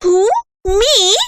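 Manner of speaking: shocked to be singled out; startled child. Acted. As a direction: Matilda answering the headmistress, startled. Accused child answers.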